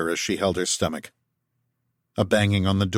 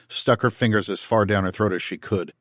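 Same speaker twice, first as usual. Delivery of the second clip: ordinary delivery, nothing marked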